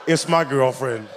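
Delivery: ordinary talk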